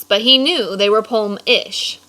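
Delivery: plain reading voice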